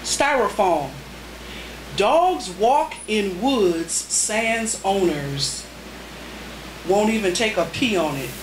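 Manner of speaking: spare, paused haiku reading; deliberate poetry reading; haiku recitation. Acted. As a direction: let each haiku land.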